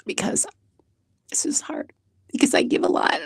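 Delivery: breaking down into a whisper; barely voiced, holding back tears. Real, not acted. Confessing her struggle to the interviewer, whispered. Tearful confession, voice dropping away.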